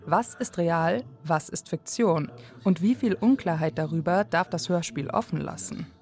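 ordinary delivery

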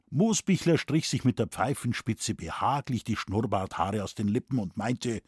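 plain reading voice

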